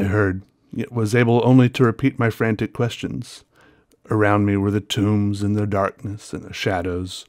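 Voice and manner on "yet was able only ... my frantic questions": ordinary delivery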